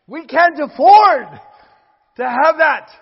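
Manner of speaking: full-force revival preaching; fiery preaching. Real, not acted. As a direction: hammer the warning home to the congregation. Preaching climax.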